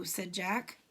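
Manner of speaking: ordinary delivery, nothing marked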